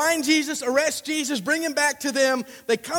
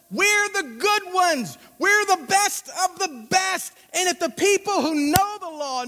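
self-important insistence; 5 semitones higher than usual. Acted. Pharisees to temple guards about being the smart ones, emphatic self-importance. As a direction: hammer out each claim of superiority.

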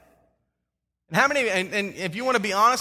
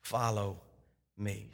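low, soft, slow invitation; Jesus calls Matthew, quiet and slow; gentle call; 12 semitones lower, 12 dB quieter, slower than usual. Acted. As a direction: draw him in softly, word by word. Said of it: "and he said".

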